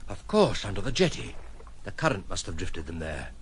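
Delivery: normal talking voice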